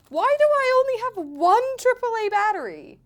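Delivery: high, loud wail of dismay; exasperated outcry. Real, not acted. Streamer hunting for spare batteries, exasperated outcry. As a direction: cry out in disbelief at your bad luck.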